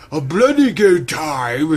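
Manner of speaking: lightly mocked British accent; bad British accent. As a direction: ham up a clumsy British accent, slow. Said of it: "Like a bad accent".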